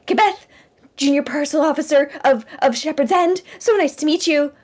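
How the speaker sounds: high, loud, eager introduction; eager young clerk introducing herself; chirpy official greeting. Acted. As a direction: beam it out like a proud junior clerk. Player voices chirpy Kebeth introduction.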